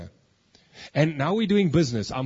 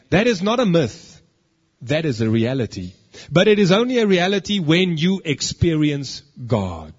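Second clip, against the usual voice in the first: louder, emphatic pulpit delivery; preaching at full force; 5 dB louder than usual. Acted. Preacher drives his point home to the congregation, loud and emphatic. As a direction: hammer each claim home to the congregation.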